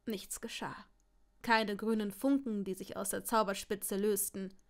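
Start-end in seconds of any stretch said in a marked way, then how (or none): none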